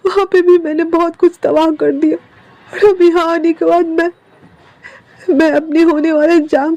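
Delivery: choked-up guilty apology; tearful confession. Acted. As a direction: beg forgiveness through tears.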